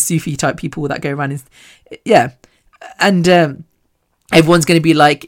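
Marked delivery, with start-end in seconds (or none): none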